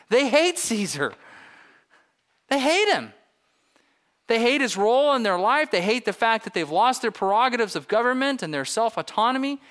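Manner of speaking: loud, high, impassioned preaching; full-force preaching. Real, not acted. Preacher telling the congregation how the people loathe Caesar, preaching forcefully. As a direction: hammer each statement home at full voice.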